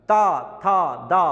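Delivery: ता था दा said loudly, the tongue striking the teeth; dental syllables loud. Shown as a demonstration. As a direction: stress the teeth on ता था दा. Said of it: "दांत ना होते तो शायद ता निकलता ही नहीं".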